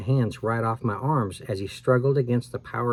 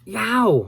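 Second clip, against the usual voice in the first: sudden high yelp, much higher; yelp of pain; 10 semitones higher, 4 dB louder than usual. A narrator's reading. Al yelps as the ghost yanks his hair: sharp pained yelp. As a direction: yelp as your hair is yanked. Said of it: "I cried".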